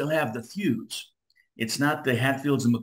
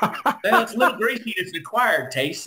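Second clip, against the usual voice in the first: local voice; 9 semitones higher, 4 dB louder than usual. Retold or imitated. Nurse's family explains squirrel dinner.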